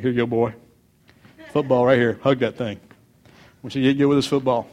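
lightly voiced football dad, low; low-voiced coaxing of a baby. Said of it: "some fathers will do this".

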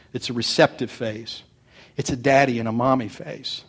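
ordinary delivery

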